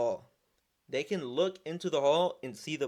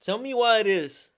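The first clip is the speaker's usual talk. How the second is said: higher and louder, real exasperation building; getting riled up; 7 semitones higher, 7 dB louder than usual. Real, not acted. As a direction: let the outrage burst out, pitched high. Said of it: "I'm finna get riled up".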